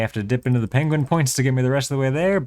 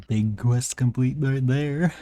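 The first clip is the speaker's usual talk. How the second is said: YouTuber voice; slower than usual. Acted. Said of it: "Is that a YouTuber voice or not?".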